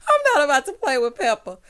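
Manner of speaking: high, loud words through leftover laughter; laughing. Real, not acted. Podcaster refusing to play along, still laughing. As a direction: talk while recovering from cracking up.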